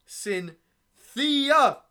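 name broken into drawn-out syllables, ending loud; hammed-up slam poet, stretching the name; slam poetry. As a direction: build to a high, loud final syllable. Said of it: "I'm gonna perform this poem".

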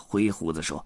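ordinary delivery, nothing marked

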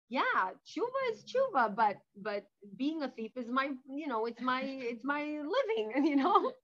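loud, slow, shameless shrug with a laugh; cheeky thief. Retold or imitated. Thief answering the rabbi, loud laughing cheeky shrug. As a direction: explain it like it's obvious.

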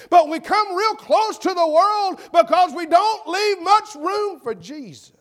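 high, rhythmic pulpit cadence; full-force preaching. Acted. Preacher to congregation on what they make room for, impassioned.

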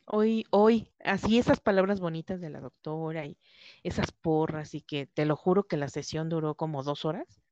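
usual speaking voice

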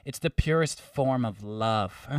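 gently mocking ethereal delivery; majestic woman. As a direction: draw it out like a blissed-out mystic. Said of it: "majestic woman just, like, came out".

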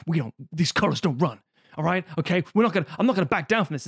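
brash patriotic bluster, speeding up; macho bluster. Retold or imitated. Podcaster mimicking Ricky Bobby refusing to back down, blustering. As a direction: puff up like a stubborn American racer.

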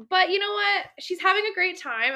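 ordinary delivery

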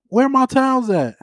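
high mock old-man voice; Papa from the shower asking for towels; imitating Papa. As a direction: ask like a grumpy grandpa. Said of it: "imitating Papa from the shower".

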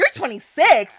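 disbelief. Retold or imitated. Retold disbelief at his age.

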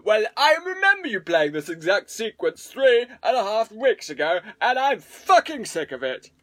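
mock bored viewer. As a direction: complain like a fed-up fan. Imagined bored viewer complaining.

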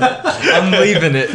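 slow, high, giggling words; laughing while talking. Real, not acted. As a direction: say it through laughter.

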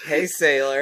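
hammy seductive greeting; exaggerated cartoon intonation. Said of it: "Why are you talking like that?".

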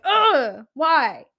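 loud, high exasperated groan; mock exasperation. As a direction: groan it out in protest at a friend. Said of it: "get mad at my friends".

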